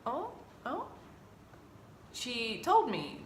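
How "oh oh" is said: higher, softer surprised oh; startled oh. Retold or imitated. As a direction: caught off guard. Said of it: "And I'm like".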